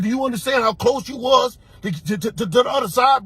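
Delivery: high threatening yell to the disrespectful barber; retold threatening shout. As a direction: build to a yell on the other side. Confronting the barber, voice rising.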